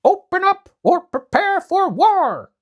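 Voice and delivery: very high, loud, slow threat; dog's war cry. Acted. Dog threatens the demons through the gate, loud. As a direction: bellow it at the gate. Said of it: "the dog shouted".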